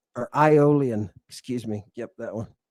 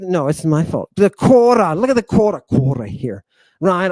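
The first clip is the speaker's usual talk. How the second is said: usual speaking voice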